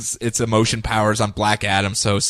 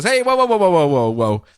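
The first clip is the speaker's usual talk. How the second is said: placating whoa; 3 semitones higher, 3 dB louder than usual. Acted. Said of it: "he goes".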